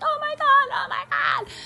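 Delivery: very high, loud mock shriek; imitated freak-out. Imitating the lady from the video, high-pitched freak-out. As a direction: squeal it like someone freaking out. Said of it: "freaking out and she's like".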